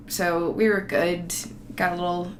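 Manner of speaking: normal talking voice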